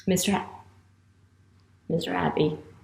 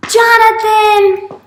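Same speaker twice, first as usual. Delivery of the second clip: high, loud, drawn-out name; excited announcement; 10 semitones higher, 8 dB louder, much slower than usual. Pretend. Teacher announces the puppet's chosen pupil, excitedly. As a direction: cry out the name like a winner's.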